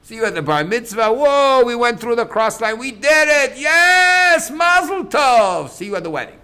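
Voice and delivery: loud, high whooping cheer; celebrating. Acted. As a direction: cheer it like a boy who just finished his bar mitzvah. Kid celebrating bar mitzvah.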